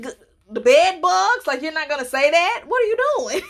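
high, sassy mock scolding; mock indignant. To daddy who skipped the bedtime rhyme, high mock scolding. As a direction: scold him like a spoiled kid.

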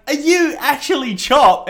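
high, loud, slow disbelief; excited question. Retold or imitated. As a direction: ask it wide-eyed and thrilled. Excited retold question to stranger.

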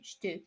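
estou reduced to "shtoo"; soft one-syllable "estou", shtoo; elided first vowel. Shown as a demonstration. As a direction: start "estou" straight on SH, no E. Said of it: "So you say".

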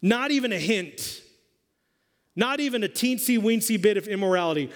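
emphatic sermon delivery; preaching. Acted. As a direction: preach it to the room.

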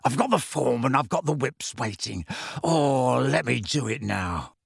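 eager caretaker begging; hoarse, gleeful pleading. A narrator's reading. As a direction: beg with hoarse delight. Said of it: "almost crying with happiness".

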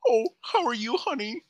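a hint of [name] greeting honey; playful [name] voice; [name] impression. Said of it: "It's me, [name]".

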